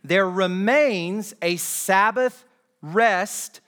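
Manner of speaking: projected, deliberate pulpit reading; slow scripture reading. Real, not acted. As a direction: read it out slowly to the whole church. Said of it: "I want to read these slowly".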